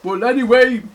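high, loud scolding bear; hammed-up told-you-so; Big Bear. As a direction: chide like a storybook parent bear. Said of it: "Big Bear goes".

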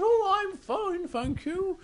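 reply sweetly as a high-voiced princess; storybook princess. Princess answers Big Bear, high and drawn-out. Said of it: "And she goes".

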